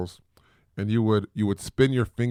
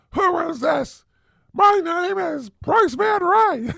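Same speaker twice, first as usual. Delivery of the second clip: much higher, louder mock scream, slowing on the name; 4 dB louder, slower than usual. Shown as a demonstration. Parent showing kids how not to call, mock screaming. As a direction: shriek the intro like a crazy person. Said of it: "not to be screaming like".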